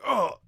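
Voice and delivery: short loud imitated yelp; mock yell. Streamer imitates the man yelling in the clip, loud mock yelp. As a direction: mimic the man's yell, high and loud. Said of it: "You can hear him be like".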